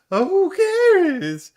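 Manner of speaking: squeaky high, slow voice-cracking whine; high cracking "who cares"; voice crack mockery. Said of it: "do you hear the voice crack?".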